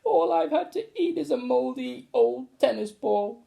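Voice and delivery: put-on high child's voice pleading; boy crying for help. Trapped boy crying for help to the teacher, high child's voice. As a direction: cry out from the cupboard. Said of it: "cried the boy".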